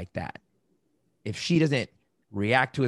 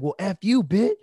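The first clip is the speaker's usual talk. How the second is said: higher, louder snapping comeback; mock angry comeback; 7 semitones higher, 5 dB louder than usual. You swearing back at the partner, mock angry comeback. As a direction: fire it right back, mock-furious. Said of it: "and you're like".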